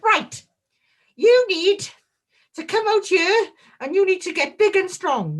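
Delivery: hammed-up big man's voice, loud and strained; Keith. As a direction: boom out commands in a forceful put-on voice. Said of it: "in his deep voice".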